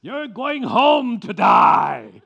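put-on high, very loud, stretched-out Aussie accent; bad Australian accent. Surgeon telling patient he's going home, bad Australian accent. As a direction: shout it slowly in a hammed-up Australian accent. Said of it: "copying of an Australian accent".

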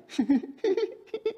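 a high, airy giggle. Shown as a demonstration. Preacher acting out Abraham's laugh as a giggle. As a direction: giggle like an old man amused. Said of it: "笑了起来".

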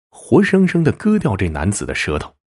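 plain reading voice

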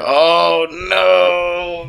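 long, high drawn-out groaned words; mock dismay. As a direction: hold each word out in comic dread. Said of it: "And you guys are like".

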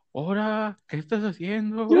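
put-on high, loud kid's yell; cousin's shout. Little cousin catches them kissing and shouts. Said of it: "un grito de mi primo".

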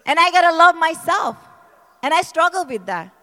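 loud, high, impassioned shouting sermon; preaching at full force. Acted. Testifying to the church about freedom, shouting preaching. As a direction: build to a fervent climax and cry it out.

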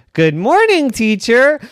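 high, loud sing-song reply; toy chorus. Acted. Stuffed animals answer teacher in sing-song chorus. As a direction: answer like a class of toys.

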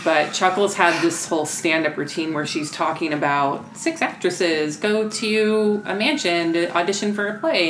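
ordinary talk, nothing marked

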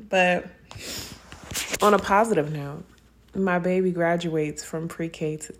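ordinary talk

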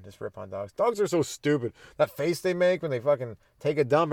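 ordinary delivery

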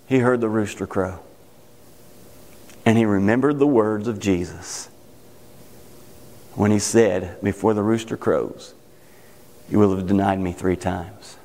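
very low, grave storytelling; solemn preaching. Real, not acted. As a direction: sink low as the realization lands. Solemn climax of Peter's denial.

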